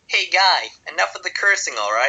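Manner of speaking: high, loud put-on voice of a fat American. Fat American scolding a swearing Scot in a film, high and loud. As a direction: play a pushy American telling a man off. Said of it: "really fat American, like, waddles over".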